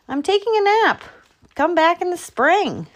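high squirrel brush-off; high-pitched, unwilling animal character. A narrator's reading. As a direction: play a squirrel sending a visitor away. Squirrel sends Groundhog away.

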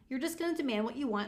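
ordinary delivery

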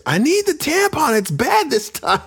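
high-pitched hammed-up wife yelling; mock scream. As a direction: fake a desperate shout from downstairs. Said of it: "My wife screaming from downstairs".

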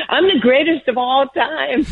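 high-pitched, playful bragging; cheeky boast. Retold or imitated. Young athlete boasting to Muhammad Ali himself, cheeky.

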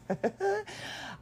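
high, light chuckle; amused little laugh, pitched high. Real, not acted. Laughs at son's well-timed shout.

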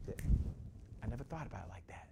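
hushed, awed whisper; whispered client awed by a new idea. Retold or imitated. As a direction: breathe it as if stunned by the idea. Client's voice in dialogue.

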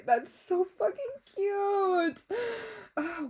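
slow, high, crying cry-out; tearful gush. Real, not acted. Reacting to a fiancé's gift, close to tears. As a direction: let it crack like you're about to cry. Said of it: "I'm gonna cry".

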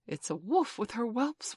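excited. A narrator's reading. Child answers excitedly about sight.

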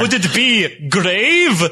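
very slow campy vampire pun; Count Jacula vampire voice. Acted. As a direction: drag it out like a vampire. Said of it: "Count Jacula".

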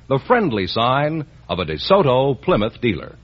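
usual speaking voice

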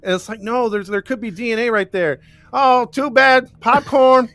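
high, loud goofy cop voice lampooning the officers; mocking lazy cops. Mocking the careless police at the crime scene, goofy high voice.

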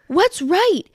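high, loud mock bewilderment; put-on high whine of confusion; clueless driver.

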